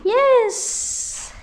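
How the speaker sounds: high loud triumphant yes; excited cheer. Real, not acted. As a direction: cheer it with delight. Ugali turned out well.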